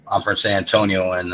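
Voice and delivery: normal talking voice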